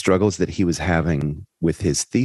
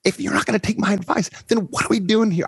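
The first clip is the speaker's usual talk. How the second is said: much higher, snapping shout; retold angry yell; much faster than usual. Teacher snapping at his struggling student, high retold yell. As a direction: bark it at him like a furious teacher. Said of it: "I yelled at him".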